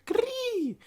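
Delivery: high screeching bird call; eagle cry. Acted. Rider sending off his eagle, high bird screech. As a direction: shriek like a hawk.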